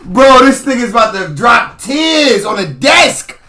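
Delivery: drawn-out loud mocking yell; slow jeering shout. Real, not acted. Teasing the tearful host, slow mocking shout. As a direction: stretch it out to milk the roast. Mocking Envy about to cry.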